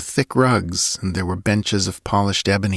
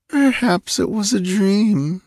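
high, slow words through a yawn; 11 semitones higher, slower than usual. A narrator's reading. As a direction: say it mid-yawn, drowsy. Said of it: "she yawned".

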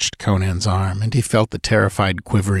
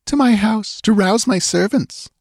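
put-on higher voice, anxious young woman pleading; frightened young woman; 13 semitones higher than usual. A narrator's reading. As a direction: voice the scared dancing girl, pitched up. Girl voiced in story.

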